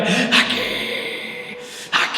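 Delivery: mock cry of a headless pirate; headless pirate's cry. A narrator's reading. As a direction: call it out like a phantom in the night. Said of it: "gritando aquellas palabras que decían".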